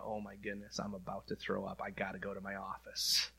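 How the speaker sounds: low, hushed inner voice; private worried aside. Retold or imitated. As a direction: mutter a queasy thought to yourself. Retells his private panicked thought.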